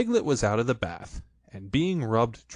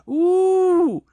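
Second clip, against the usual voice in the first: high, loud yelp going up; high ooh; 11 dB louder than usual. A narrator's reading. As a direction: yelp as he flies up. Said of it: "he said".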